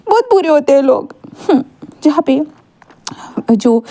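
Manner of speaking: a touch of crying in the voice; tearful. Acted. Mother sighs to her son about cheating people, a little tearful. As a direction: say it through light tears. Mother consoles the upset child.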